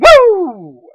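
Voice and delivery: sudden loud woo; excited whoop. Acted. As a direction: whoop it out at the chop. Big chop lands in match.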